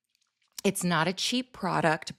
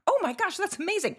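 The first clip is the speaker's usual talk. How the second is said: amazed gush; faster than usual. Retold or imitated. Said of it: "they're like".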